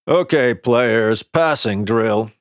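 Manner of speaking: slow, drawn-out call to the team; coach calling out. A narrator's reading. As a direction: call the drill out slowly across the field. Coach calls out to players.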